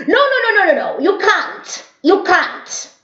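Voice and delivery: shrill-pitched imitation of an angry neighbor; shouting neighbor.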